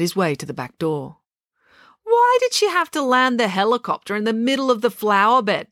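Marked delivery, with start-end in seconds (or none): 2.0-5.7 high-pitched indignant demand. A narrator's reading. April confronts Joe as he steps inside, demanding. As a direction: demand an answer, starting high and outraged. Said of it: "demanded April".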